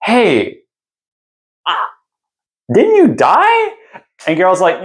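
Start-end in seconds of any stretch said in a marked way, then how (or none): 0.0-3.7 hesitant, then very high-pitched question; stall, then squeak out the awkward question; mock bewilderment with long pauses; puzzled dwarf. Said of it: "And he was like".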